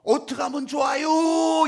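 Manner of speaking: anguished cry. Acted. Mourner over past events, slow desperate cry. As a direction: cry out in despair.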